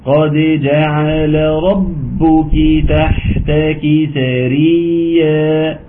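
slow drawn-out tajweed chant; Quran recitation. Shown as a demonstration. Teacher reciting Sura Maryam to students, slow chanted recitation. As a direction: recite slowly, stretching the vowels. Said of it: "قلقلة الدال".

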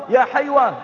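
high-pitched retold call; mild imitation of a mother's yell; mock shouting. Mother calling her son from the mosque window, mild mock yell. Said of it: "ثم تصرخ به تقول".

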